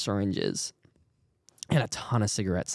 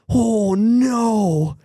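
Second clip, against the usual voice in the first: loud, high, drawn-out dismay; mock alarm; 10 semitones higher, 7 dB louder than usual. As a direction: cry out in retold dread. Retold alarm at seeing syringe.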